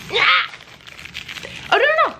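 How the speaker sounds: shrill, high alarmed cry. Real, not acted. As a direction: yelp in sudden dismay. Alarm at wrong fortune cookie.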